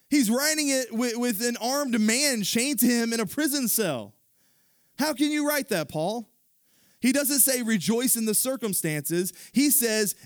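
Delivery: high, loud preaching voice; full-force preaching. Acted. Preacher driving the point home, full force. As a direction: preach it hard to the congregation.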